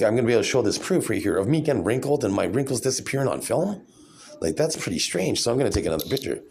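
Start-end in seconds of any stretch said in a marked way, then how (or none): none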